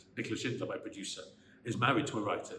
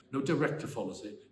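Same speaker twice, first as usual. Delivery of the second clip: usual speaking voice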